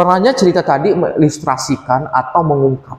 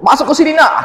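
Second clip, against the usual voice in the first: calling son inside; 11 semitones higher, 3 dB louder, faster than usual. Acted. Father guides son into cave.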